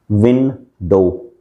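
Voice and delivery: Window slowly as two separate beats, विं, then डो; slow syllable-by-syllable demo of window; split into syllables. Shown as a demonstration. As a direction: break window in two with a clear pause between syllables. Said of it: "धीरे धीरे बोलना है".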